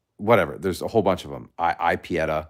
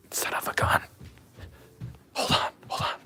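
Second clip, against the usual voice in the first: whisper; 5 dB quieter than usual. Real, not acted. Muttering off-mic while live.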